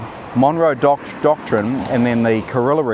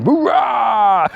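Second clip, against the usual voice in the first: high, hammed-up whoop of a TV-news fan; mock cheer; 11 semitones higher, 3 dB louder than usual. Mocking Western viewers cheering at the news. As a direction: cheer like a gloating armchair patriot. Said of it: "They're just watching Fox News going".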